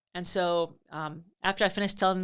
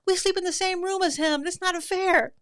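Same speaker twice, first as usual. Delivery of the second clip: high, loud imitated kid complaint; boys' upset protest; 12 semitones higher, 5 dB louder than usual. Boys sharing a room with him, aggrieved wail. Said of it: "some of the boys were like".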